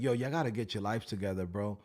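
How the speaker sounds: low preachy advice. Retold or imitated. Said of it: "like I'm preaching him".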